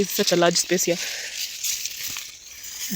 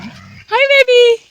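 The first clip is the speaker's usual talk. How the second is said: very high, loud cooing greeting; 7 dB louder, much slower than usual. Real, not acted. Greeting her old dog.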